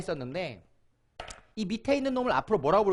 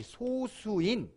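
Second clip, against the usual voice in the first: slow emphasis; 2 semitones higher, 4 dB quieter, much slower than usual. Shown as a demonstration. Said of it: "teacher models the term".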